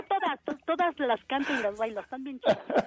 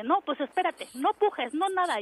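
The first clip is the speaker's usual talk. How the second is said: higher, insistent imitation; retold urgent order; 6 semitones higher than usual. Staff to laboring mother not to push, retold urgency. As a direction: imitate staff telling a patient to stop.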